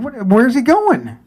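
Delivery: high, drawn-out disbelief; acting out baffled protest; incredulous question. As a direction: stretch it out in high-pitched bewilderment. Retold disbelief as driver leaves.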